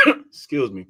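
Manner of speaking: sudden sneeze into the words; sneeze, then carry on; real sneeze. Real, not acted. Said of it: "I don't know why I keep sneezing".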